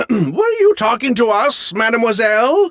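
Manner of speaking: high-pitched, put-on innocent surprise; feigned innocence. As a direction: play dumb, voice lifted high. Feigns ignorance of the names.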